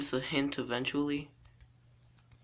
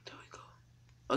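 whispering while hiding; 9 dB quieter, much slower than usual. Real, not acted.

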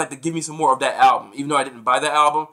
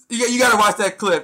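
usual speaking voice

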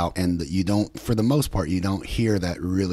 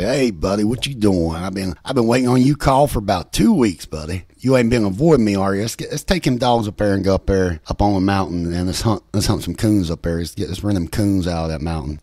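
put-on thick West Virginia accent; 5 dB louder than usual. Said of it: "these really thick West Virginia accents".

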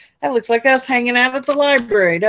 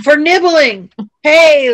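higher, much louder laughing cry; 7 semitones higher, 10 dB louder, slower than usual. A narrator's reading. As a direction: burst out laughing at the nibbling. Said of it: "laughed Buster".

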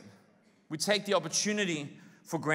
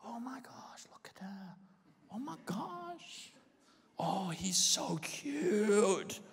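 breathy, much softer infatuated gush; lovestruck; 8 dB quieter, slower than usual. Retold or imitated. Newly in love, gushing softly over partner. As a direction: swoon over your new love. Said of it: "when you fell in love".

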